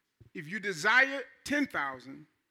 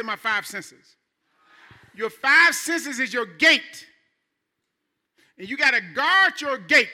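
full-force preaching; 4 semitones higher, 8 dB louder than usual. Acted. Pastor rousing his congregation to guard their minds, fiery full-force preaching. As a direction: preach it hard to the back row, stretching the key words.

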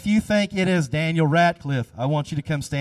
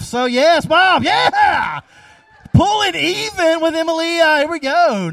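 shouted, high cheer for Bob; excited cheer from the front as Bob ties it; 11 semitones higher, 8 dB louder than usual. Real, not acted. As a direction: whoop it up as he ties the game. Cheering Bob tying the game.

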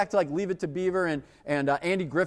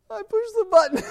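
much higher, small frightened voice; squeaky confession; 5 dB louder than usual. Retold or imitated. Teen admitting to the boss, squeaky and scared.

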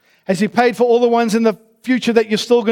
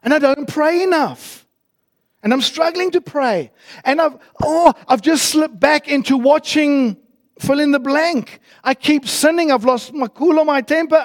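higher, worried confessing voice; anxious struggling believer; 8 semitones higher, 4 dB louder than usual. Retold or imitated. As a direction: pour out your failures, fretting. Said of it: "They say".

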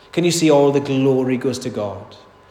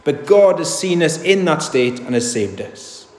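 ordinary delivery

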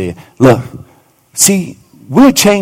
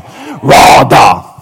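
husky shout; 3 semitones lower than usual. Shown as a demonstration. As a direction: belt out radah. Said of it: "get your husky voice on".

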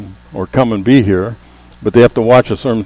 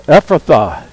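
raised, emphatic command; a firm, louder scriptural command; 5 semitones higher, 4 dB louder than usual. A narrator's reading. Preacher reads out Jesus's healing word, commanding.

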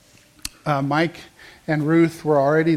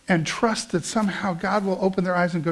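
ordinary talk, nothing marked

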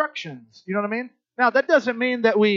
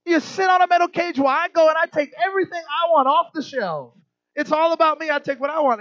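indignant mock; 9 semitones higher, 4 dB louder than usual. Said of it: "he's like".

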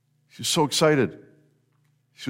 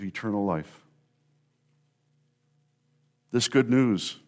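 lower, measured praying voice with pauses between phrases; closing prayer; 4 semitones lower, 4 dB quieter than usual. Real, not acted. Preacher thanking God for Easter hope, in subdued prayer. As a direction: pray it gently and unhurriedly, dropping lower toward the amen.

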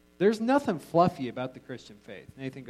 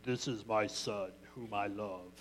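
voice of God; 5 semitones lower, 9 dB quieter than usual. Retold or imitated. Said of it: "the dove came down and said".

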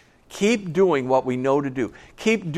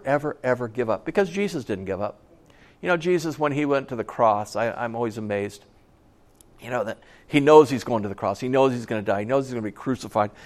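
normal talking voice

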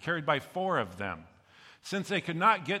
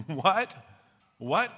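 a sharp, louder rising "What?", hammed-up shock; mock disbelief; 5 semitones higher, 5 dB louder than usual. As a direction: throw out a stunned double take. Preacher reacts to Jesus' words.

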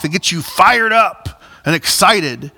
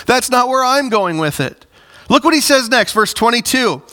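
fervent preaching; 7 semitones higher than usual. Acted. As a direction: push the point home to the congregation. Preaching at full force.

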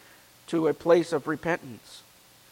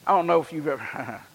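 normal talking voice

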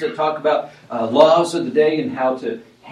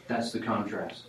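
usual speaking voice

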